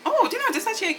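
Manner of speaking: delighted it actually works; a little gasp of surprise at the mirror; pleasantly surprised oh. Real, not acted. Surprised makeup is going fine.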